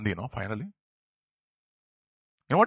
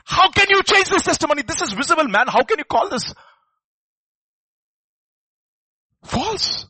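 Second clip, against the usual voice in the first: much higher, loud pleading question; impassioned outcry; 11 semitones higher, 6 dB louder than usual. Acted. Preacher challenging doubters, high and loud. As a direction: cry it out in disbelief.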